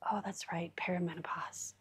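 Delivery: hushed aside to herself; whispering. Real, not acted. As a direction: drop to a whisper. Muttered aside about perimenopause.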